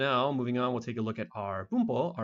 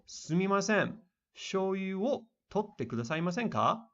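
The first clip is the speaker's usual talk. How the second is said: really polite request; 8 semitones higher than usual. Shown as a demonstration. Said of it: "trying to be really, really polite".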